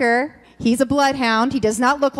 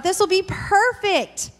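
naive hope; 8 semitones higher, slower than usual. Retold or imitated. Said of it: "So I thought".

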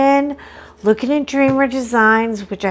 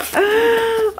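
sharp high startled gasp; 12 semitones higher than usual. Retold or imitated. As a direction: gasp in sudden delight. Overwhelmed by the painting.

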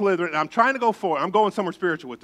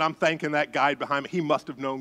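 usual speaking voice

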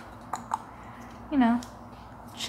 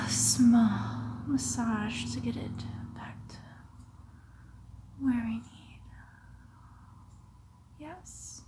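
sinister soothing whisper; 4 dB quieter than usual. Acted. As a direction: lull the patient with a whisper. Whispered massage of the heart.

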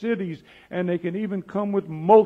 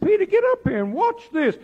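high-pitched call to come up and watch; guide calling out; 3 dB louder than usual. Retold or imitated. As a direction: call him up, voice pitched much higher.

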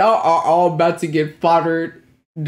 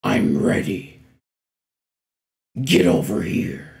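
demonic voice; 10 semitones lower than usual. Retold or imitated. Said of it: "that demonic voice from Aang".

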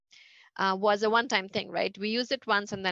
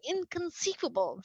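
mock-incredulous Vizzini, pitched up and a little softer; Vizzini's catchphrase; 8 semitones higher, 4 dB quieter than usual. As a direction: toss off a high, disbelieving "Inconceivable". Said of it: "the Sicilian would keep saying".

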